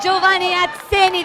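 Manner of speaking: high, thrilled shout of the name; excited announcing. Real, not acted. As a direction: call the winner out in triumph. Announcing the victorious jockey.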